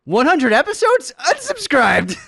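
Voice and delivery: very high, loud incredulous yell; mock outrage. Host reading a joke complaint, mock-outraged shout. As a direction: scream it in comic indignation.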